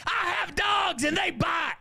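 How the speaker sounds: high-pitched threatening holler; hammed-up shrieking neighbor lady; shouted warning. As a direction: scream a warning to back off.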